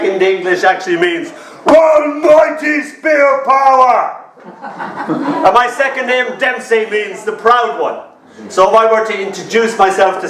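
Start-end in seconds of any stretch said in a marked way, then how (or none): 1.7-4.2 grand proclamation. Real, not acted. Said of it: "laugh shout".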